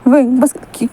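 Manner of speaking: plain reading voice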